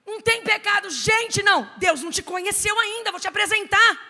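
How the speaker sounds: high, loud hammed-up preacher exclamation; mock exclamation. Pastor loudly exclaims that God hasn't met the woman yet. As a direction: proclaim it as if shocked.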